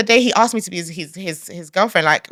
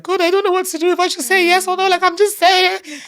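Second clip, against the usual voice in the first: higher, pleading mock-whine; whiny prayer to God; 9 semitones higher than usual. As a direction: complain to God like a fretful child. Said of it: "I was just there like".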